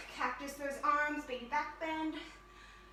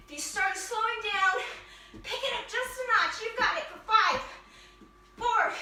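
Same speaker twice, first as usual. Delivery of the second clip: raised, louder workout-instructor voice; energetic coaching call-out; 5 semitones higher, 4 dB louder than usual. Real, not acted. Instructor urging class through mountain climbers, loud coaching. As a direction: push the class on, pitched up and projecting.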